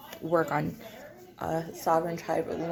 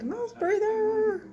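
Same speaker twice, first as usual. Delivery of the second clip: mock insult; much slower than usual. Mocking own mascara face.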